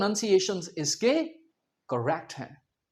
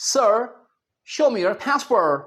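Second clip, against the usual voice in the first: slow American airport officer; American English, "pæs-perr" with curled r; American accent; much slower than usual. Acted. US airport officer to arriving traveller, American accent. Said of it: "और वहां आपको कहेंगे".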